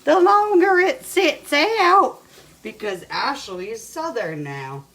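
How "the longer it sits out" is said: high, loud, slow mock quote of her partner; imitating Ashley. Teasing Ashley about the bacon, a high, loud, slow mock quote. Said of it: "She said".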